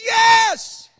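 high, loud acted yell; desperate man's reply. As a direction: holler back up from the cliff. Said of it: "He says".

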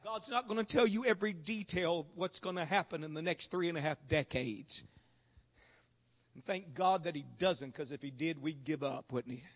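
low, soft preacher's voice with long pauses; hushed pastoral appeal. Real, not acted. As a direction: drop right down and search their hearts. Preacher drops to low aside.

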